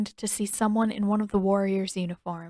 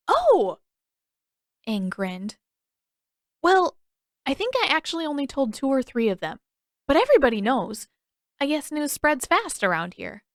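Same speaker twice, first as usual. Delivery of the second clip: cheerful; 6 semitones higher than usual. Acted. Aang cheerful, oblivious.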